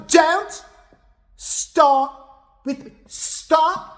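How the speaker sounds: high mock-angry cut-off; imitated scolding. Kim cutting off the splashing girl, imitated high scolding. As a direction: scold in short, high-pitched bursts.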